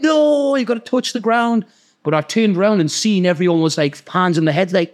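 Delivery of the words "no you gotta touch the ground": a much higher voice, exasperated; imitating a teammate's frantic correction; high mock correction. Teammates telling him to touch it down, high exasperated mock call.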